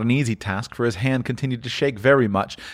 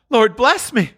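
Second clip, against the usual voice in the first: high, stunned exclamation; astonished cry; 12 semitones higher, 4 dB louder, slower than usual. Acted. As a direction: cry out as if your breath were taken away. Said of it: "as if his breath were taken away".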